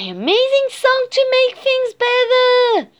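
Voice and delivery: high, loud, elated cry; thrilled exclamation. A narrator's reading. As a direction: cry out in joyful amazement. Cat delighted that song works.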